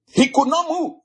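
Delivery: very high-pitched exclamation; fervent preaching. Real, not acted. Preacher marvels that Jesus stopped, high fervent cry. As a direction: exclaim it high, in full fervor.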